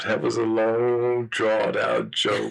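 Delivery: "long" and "drawn-out" stretched very slowly; slow, teasing stretch on "long, drawn-out". Real, not acted.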